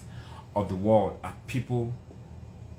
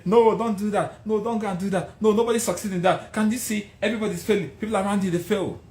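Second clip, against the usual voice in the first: high discouraging voice of doubters; hint of scolding naysayers; mock naysayers; 9 semitones higher, 4 dB louder, faster than usual. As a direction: imitate people who talk you out of it. Naysayers voiced telling him no.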